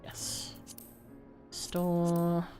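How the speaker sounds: ordinary delivery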